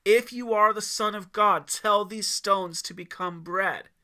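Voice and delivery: loud, high declared challenge; announced challenge. A narrator's reading. Devil announcing his challenge to Yeshua, loud. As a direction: proclaim the dare. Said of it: "announced his challenge one more time".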